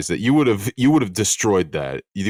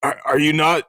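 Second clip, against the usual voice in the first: incredulous question; 8 semitones higher than usual. Retold or imitated. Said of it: "He's just like".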